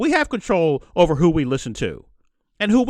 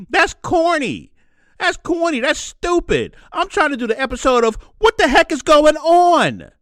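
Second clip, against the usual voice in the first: worked up; 9 semitones higher than usual. Real, not acted. Host venting to viewers about the state of things, exasperated. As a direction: push it up like you can't believe it.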